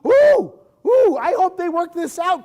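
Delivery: anxious audience. Retold or imitated. Audience hoping the couple works it out, high anxious squeal. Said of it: "we're really".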